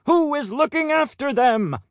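a fussy head peacock; pompous peacock. Acted. Head peacock questions the flock.

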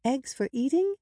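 put-on high child's question; small, high-pitched younger sister; curious little girl. A narrator's reading. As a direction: ask innocently in a high little voice. Little girl asks about story.

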